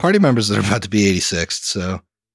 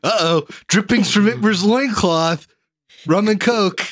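much higher, slowed showy naming; mock cocktail naming; 8 semitones higher, slower than usual. Host teasing friend's naming of rum and Coke, hammed-up announcing. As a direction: present a ridiculous drink name in a high voice. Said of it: "like if Emily named a fucking rum and Coke".

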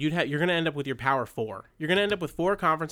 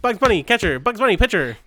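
loud, high stadium announcer calls; 6 semitones higher, 8 dB louder than usual. Retold or imitated. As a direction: boom each name like a ballpark announcer. Calling out cartoon lineup.

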